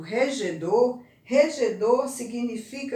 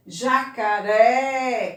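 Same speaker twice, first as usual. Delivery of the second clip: "Jacaré" very slow, the final RÉ held long and loud; stressed syllable drawn out; 7 dB louder, much slower than usual. Shown as a demonstration. Teacher asking for the stressed syllable, last one stretched loud.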